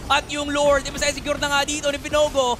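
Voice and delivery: high, loud esports commentary; excited play-by-play. Real, not acted. Caster calls Lord secured against Asi, excited play-by-play. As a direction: ride the momentum of the objective.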